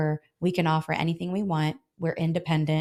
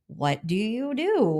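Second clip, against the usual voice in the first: slow, drawn-out, higher-pitched question; patient re-asking; 4 semitones higher, much slower than usual. Retold or imitated. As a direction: spell it out slowly for a puzzled client. Repeats question to puzzled client.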